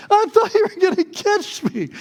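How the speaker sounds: very high, loud mock wail; pained protest. Child who fell accusing his dad, high wailing protest. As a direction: wail it like a hurt, betrayed child.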